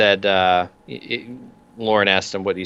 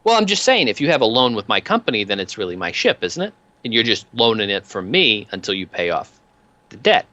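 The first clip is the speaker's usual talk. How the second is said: higher-pitched, lightly voiced thug pressing with questions; needling debt collector; 5 semitones higher than usual. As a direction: talk like a wheedling loan enforcer scoring a point. Peanuts needles the ship's captain.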